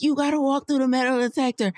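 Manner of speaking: high, bossy imitation of the guard; mock security guard. Imitating the security guard stopping her, high and bossy. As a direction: mimic the guard ordering her through. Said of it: "And he's like".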